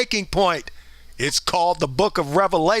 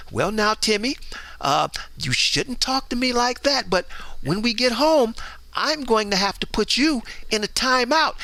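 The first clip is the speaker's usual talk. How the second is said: meek permissive mom; 4 semitones higher than usual. Retold or imitated. Mother gently scolds tantrum-throwing Timmy, lightly mocked. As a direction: timidly scold the kid, no real bite. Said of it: "the mama's like".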